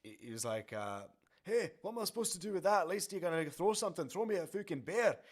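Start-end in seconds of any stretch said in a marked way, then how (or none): 1.4-5.2 a higher, rough-edged bad Scottish accent; terrible Scottish accent. Scottish singer to the bottle thrower, bad Scottish accent. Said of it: "that's my terrible Scottish accent".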